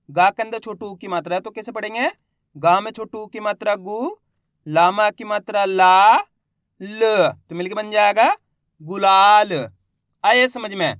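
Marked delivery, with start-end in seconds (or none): 2.6-9.5 slow, loud spelling chant with stretched syllables; letter-by-letter reading. Shown as a demonstration. Teacher modelling word-building for pupils, letter by letter. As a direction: sound out each syllable, pause, then blend loudly. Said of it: "एक ही अक्षर का उच्चारण करके इनको पढ़ रहा हूँ".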